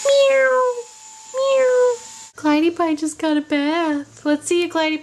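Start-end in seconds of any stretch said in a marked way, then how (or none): 0.1-1.9 high squeaky meow; cat meow. Pretend. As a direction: meow like a kitten. Imitating a cat.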